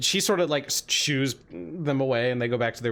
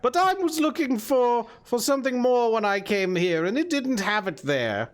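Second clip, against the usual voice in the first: lilting hag, higher than the narration; hag character; 9 semitones higher than usual. Acted. Hag recalls visiting cold parts of hell, lilting hag voice. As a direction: reminisce in the old hag's voice. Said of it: "she nods and says".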